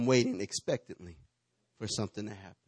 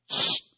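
sharp loud hushing hiss; a mama's firm shush; 12 dB louder than usual. Acted. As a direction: shush a noisy child. Mama shushing a child.

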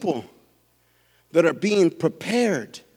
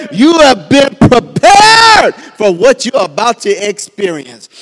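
preaching at full force; 5 semitones higher, 9 dB louder than usual. Real, not acted. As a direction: drive each "all the" harder. Builds to preaching peak.

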